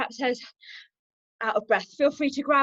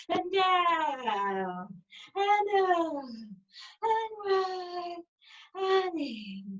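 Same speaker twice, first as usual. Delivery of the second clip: long, stretched vowels on each cue; drawn-out cues; much slower than usual. Real, not acted. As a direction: stretch every word along with the slow movement. Same cues stretched for slow circles.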